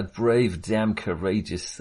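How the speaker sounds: ordinary talk, nothing marked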